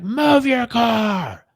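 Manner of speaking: high, very drawn-out shout; imitated angry yell. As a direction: stretch each word in a mock road-rage holler. Angry driver acted out.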